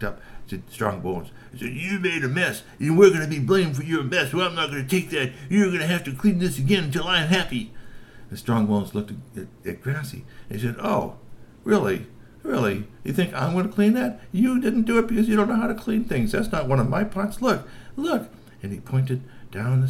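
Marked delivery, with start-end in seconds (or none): 1.7-7.7 high, pushy troll voice with a laugh; bully him into cleaning it; bossy troll demand. Acted. Grassy pushing the blame onto Strongbones, high and bossy. 10.8-18.3 high, scornful troll comeback; indignant troll retort. Acted. Strongbones scoffing back at Grassy, high and indignant.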